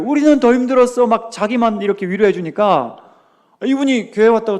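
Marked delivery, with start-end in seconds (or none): 0.0-1.1 higher, curt dismissive line; a clipped brush-off from an elder; lightly mocked older church lady; deaconess again. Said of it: "조금만 말씀하신 거예요".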